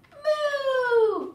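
high, loud, drawn-out moo; long playful storybook moo; baby's moo. Acted. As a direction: moo out like a delighted baby. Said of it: "Baby says".

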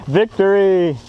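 high, loud triumphant cry; victory shout. Real, not acted. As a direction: cheer the win. Team wins the round.

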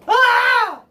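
piercing squeal of joy; overwhelmed fangirl scream; high excited fan scream. Real, not acted. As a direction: shriek at the top of the voice.

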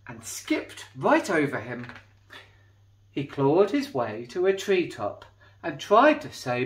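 put-on lively read-aloud for children; storybook reading. A narrator's reading. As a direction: tell it like a bedtime picture book. Reading a picture book aloud.

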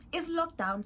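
lockdown burst out high and loud; a sudden excited cry of lockdown; exclaimed. Shown as a demonstration. Telling her friends the news, excited high loud cry.

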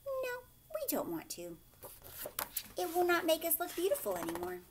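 high, fast, fussy refusal; a pampered horse whining no; vain horse. Acted.

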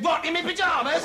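high, shouted incredulous question; shouted down the road. Acted. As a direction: yell it after someone who has gone. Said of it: "so I ran outside and said".

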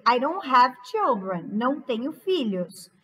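usual speaking voice

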